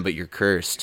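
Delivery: ominous curse. Retold or imitated. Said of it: "Crazy Ralph".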